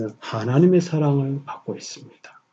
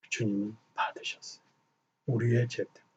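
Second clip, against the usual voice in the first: whisper; 3 semitones lower, 16 dB quieter than usual. Real, not acted. Pastor whispers that the Lord simply took it. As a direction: lean in and breathe it. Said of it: "whispered point in sermon".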